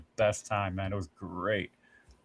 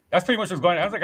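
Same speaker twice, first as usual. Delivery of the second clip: ordinary delivery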